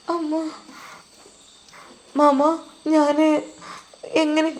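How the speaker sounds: put-on high, small, hesitant girl's voice; a scared child pleading, drawn out slow; frightened little girl. Frightened girl answers stepmother. (ഭയന്ന കൊച്ചുകുട്ടിയുടെ നേർത്ത ഉയർന്ന ശബ്ദം)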